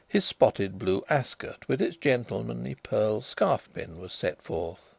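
ordinary delivery

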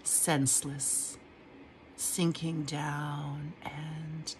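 slow, hushed, trance-like reading; dream poem reading. Acted. Reading her dream poem aloud, slow and hushed. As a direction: sink into it, slow, nearly whispering.

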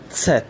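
زد said as "tset", opening on t and s run together; German Z as ts. Shown as a demonstration. Teacher names the last letter for learners, Z pronounced tset. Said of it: "به صورت ت و س".

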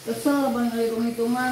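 real annoyed loud complaint; annoyed outburst. Real, not acted. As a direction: let the irritation burst out at him. Wife annoyed about money counting.

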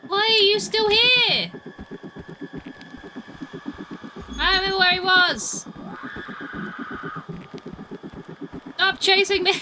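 high, loud protest at a pursuer; exasperated shout. Real, not acted. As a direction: yell back at whoever keeps chasing you. Shouts at enemy still chasing him.

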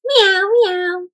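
high playful meow; mock kitty meow; cat meow. Shown as a demonstration. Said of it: "What sound would a cat make?".

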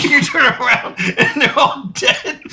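slow, high squeal through real laughter; helpless laughter. Real, not acted. Player extending a dark joke about the family, high wheezing laughter. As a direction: struggle to get it out while cracking up.

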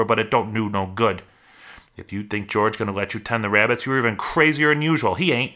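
loud, high burst, then running scold; angry berating. Acted. Rabbit threatens Lenny with George's beating, angrily berating.

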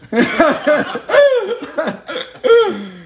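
loud, high cackle; big laugh. Real, not acted. Priest after his mouse pun, cackling laugh.

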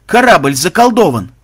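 high, loud cry of dismay; shocked cry. Acted. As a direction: call out in superstitious alarm. Shocked cry at the chest's contents.

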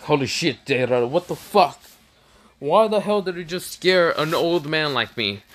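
mock-startled grumbling at a prankster; startled scolding. Startled old man scolds Deidara.

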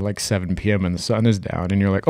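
ordinary talk

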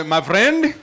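Indian accent. Shown as a demonstration. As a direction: put on an Indian accent and call it out. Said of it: "do that one in Indian accent".

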